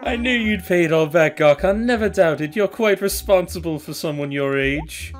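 a friendly raccoon landlord pitching; shopkeeper voice. Acted.

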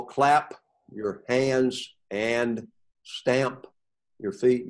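very slow, stretching each vowel; slow demo of repeated vowels; drawn-out vowels. Shown as a demonstration. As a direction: draw out every vowel so it echoes. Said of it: "now hear the vowel".

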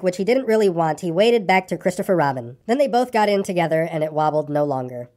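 ordinary delivery, nothing marked

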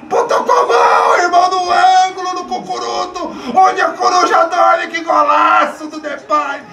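euphoric goal shouting; explosive goal commentary. Real, not acted. Fan celebrating the goal.

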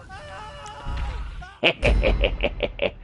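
high staccato cackle; gloating cackle. Pretend. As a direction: cackle in high, choppy bursts. Gloats as the Molotov lands.

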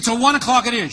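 high, loud seizing of the offer; triumphant confirmation. Retold or imitated. Patient clinching his one o'clock slot with the nurse, triumphant. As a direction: pounce loudly on the agreed time.

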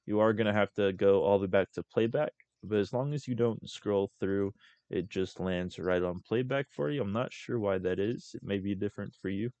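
ordinary talk

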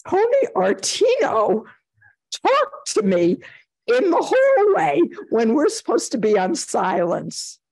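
hammed-up, high-pitched indignant tattling; outraged complaint. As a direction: re-enact an aggrieved tattletale, leaping up in pitch. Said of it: "I was just outraged".

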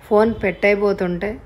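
plain reading voice

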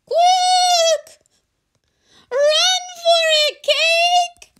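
very high, loud jelly voice; urgent squeaky jelly creature; wibbly-wobbly voice. Acted. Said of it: "a wibbly-wobbly voice said".

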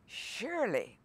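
ordinary talk

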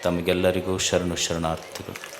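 low, soft, measured salutation in short phrases; hushed, level-pitched formal opening prayer; solemn invocation. Real, not acted. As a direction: open reverently, low and quiet, with long pauses. Opens discourse with invocation and salutation.

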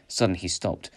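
plain reading voice

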